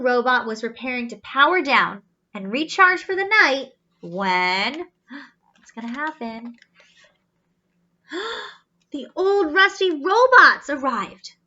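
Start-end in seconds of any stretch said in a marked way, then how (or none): none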